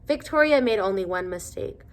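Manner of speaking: ordinary delivery, nothing marked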